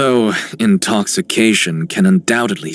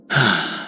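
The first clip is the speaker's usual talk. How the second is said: soft falling sigh; wistful sigh; 3 semitones lower, 4 dB quieter than usual. Acted. Character reminded of home waters, wistful sigh. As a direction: breathe out a gentle sigh.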